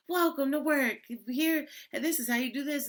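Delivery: high, bright-voiced friendly coworker welcome; sweet coworker voice. Coworker greeting the new girl, high and sweet. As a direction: chirp it like the nicest coworker.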